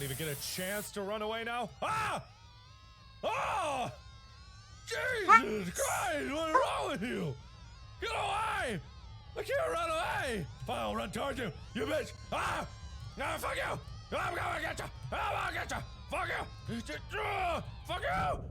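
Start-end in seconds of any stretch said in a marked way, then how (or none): 1.8-3.9 high-pitched shriek of alarm; sudden high scream at an attack; startled scream. Real, not acted. Player attacked with no chance to run, high scream. 4.9-18.4 high, panicked swearing shouts; frantic yelling. Real, not acted. Player who can't run away, high frantic shouting at enemy.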